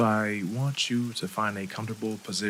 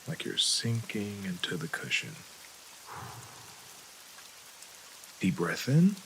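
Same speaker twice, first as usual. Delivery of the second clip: calm, unhurried meditation voice with long pauses; guided meditation; 6 dB quieter than usual. Acted. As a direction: guide them gently through each breath.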